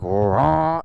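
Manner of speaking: drawn-out engine "raw"; imitated engine rev. Rider describing the right clutch sound, imitated rev. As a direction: mimic the throttle catching in a long roar. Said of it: "the sound was making the right noise".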